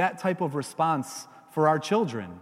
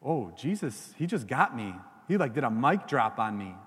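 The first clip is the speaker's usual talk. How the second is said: lower, softer, caught-out mumble; sheepish Pharisees; 8 semitones lower, 5 dB quieter than usual. Retold or imitated. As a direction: play the beaten rivals, deflated. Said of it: "the scribes and Pharisees are like".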